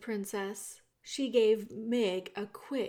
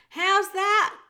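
loud, much higher shout; 10 semitones higher, 11 dB louder than usual. A narrator's reading. Meg shouting because she misheard the princess. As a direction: yell the question, not having heard. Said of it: "shouted Meg".

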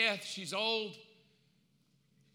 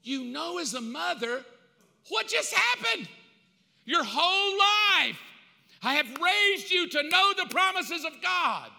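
loud, high, furious scolding; furious mother; 7 semitones higher, 8 dB louder than usual. Acted. Eve yelling at her son for killing his brother, furious. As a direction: rage at the son who betrayed the promise.

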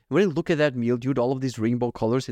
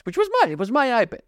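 indignant; 11 semitones higher, 3 dB louder than usual. Real, not acted. Indignant about his own iPad.

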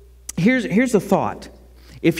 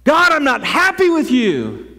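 mock angry prayer; 6 semitones higher, 4 dB louder than usual. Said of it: "If you're feeling angry at God".

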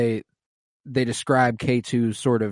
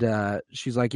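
usual speaking voice

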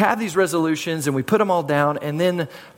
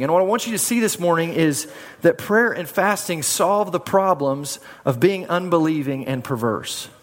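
usual speaking voice

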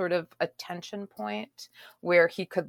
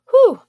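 whew; 19 dB louder than usual. Real, not acted. Reaction after a steamy reading.

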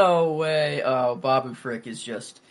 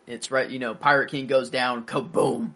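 usual speaking voice

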